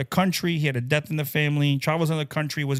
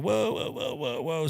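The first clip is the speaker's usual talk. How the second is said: alarmed whoa; 6 dB quieter than usual. Retold or imitated. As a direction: pull back in alarmed disbelief. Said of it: "I was like".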